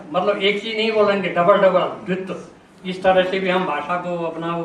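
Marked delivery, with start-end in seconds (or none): none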